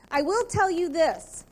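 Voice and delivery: normal talking voice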